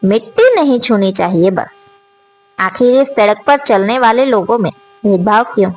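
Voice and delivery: usual speaking voice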